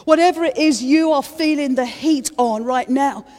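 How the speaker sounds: high, loud pleading preacher; impassioned high sermon plea; full-force preaching. Acted. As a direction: cry it out to the congregation.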